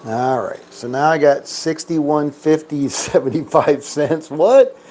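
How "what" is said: sharp, much higher, louder what; startled at the odd payout; surprised. Real, not acted.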